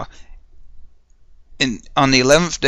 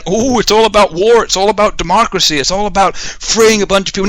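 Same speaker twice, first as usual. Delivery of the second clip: sarcastic slogans; 6 semitones higher than usual. Retold or imitated. Mocking the government's reasons for war, lightly voiced sarcasm. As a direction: parrot the official line with scorn.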